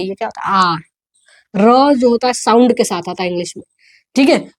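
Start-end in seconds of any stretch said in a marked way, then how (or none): 0.5-0.8 "आर" as a long aa, r barely sounded; correct letter R. Shown as a demonstration. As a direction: let "आर" fade without a full r. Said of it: "र नहीं होता है".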